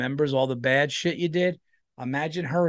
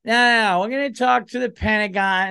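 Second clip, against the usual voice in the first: higher, louder firm refusal; mock official; 8 semitones higher, 4 dB louder than usual. Female president overruling, high and firm. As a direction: insist primly on diplomacy. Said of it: "Fuck, no, fucking what?".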